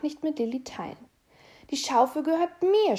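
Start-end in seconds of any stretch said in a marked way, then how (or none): 1.7-3.0 put-on loud, high, indignant child's shout; furious little mouse shouting "mine"; angry scolding. A narrator's reading. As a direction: snap at the little sister. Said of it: "schimpft er".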